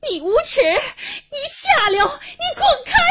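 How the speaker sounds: high-pitched screamed abuse, building; shrieking, tearful fury; frantic furious cursing. A narrator's reading. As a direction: scream at him in outrage and terror. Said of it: "气急败坏地骂着".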